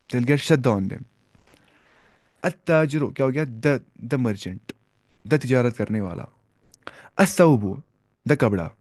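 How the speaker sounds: normal talking voice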